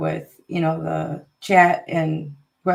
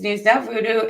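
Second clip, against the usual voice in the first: ordinary talk, nothing marked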